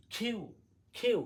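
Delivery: "খিউ" with a puff on kh; aspirated Q. Shown as a demonstration. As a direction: start "খিউ" on breathy kh, glide to yoo. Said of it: "আজ থেকে পড়বো". ("খিউ" বাতাস দিয়ে)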